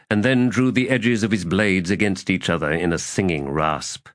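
usual speaking voice

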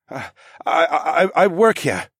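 high, halting French-tinged stammer; frightened man stuttering out an answer; nervous stammer. A narrator's reading. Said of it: "he eventually stammered".

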